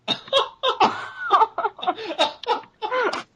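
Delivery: loud, high-pitched burst of laughter; long helpless cackle at a punchline; big laugh. Real, not acted. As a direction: crack up hard, high and loud. Laughing at girlfriend's punchline just told.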